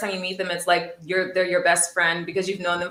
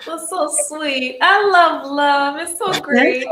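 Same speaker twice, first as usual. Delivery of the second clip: gushing; 8 semitones higher, 8 dB louder, slower than usual. Real, not acted. Gushing over sweet couple.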